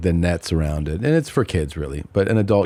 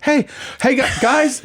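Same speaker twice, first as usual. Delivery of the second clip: mock panic. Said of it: "you see him go from one to ten panic".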